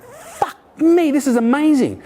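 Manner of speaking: blown away by the book; amazed. Retold or imitated. Retelling his reaction to the book, amazed.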